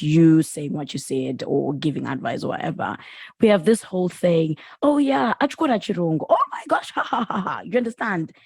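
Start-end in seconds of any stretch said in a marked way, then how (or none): 4.8-7.6 imitated sneering teasing, pitch jumping high on the exclamation; mocking ridicule. Zimbabweans laughing at each other's English, imitated mockingly. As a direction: put on a jeering mock laugh. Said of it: "why do we laugh at each other".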